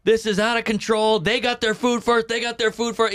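high-pitched mock outrage; dad flipping out. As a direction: imitate an indignant dad complaining in a restaurant. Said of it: "he's yelling".

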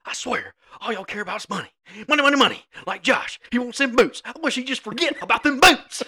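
high-pitched, loud mock rant; dad giving an earful. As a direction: imitate a fed-up father ranting on the drive home. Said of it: "he's giving you an earful".

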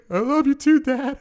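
tearful goodbye. Retold or imitated. Teen on payphone to his dad, sobbing goodbye. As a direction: choke it out through tears. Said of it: "I was replaying that moment".

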